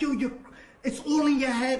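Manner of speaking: high, loud retold protest; frantic denial. Retelling baby mother denying the accusation, frantic. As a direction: pitch it up, protesting desperately.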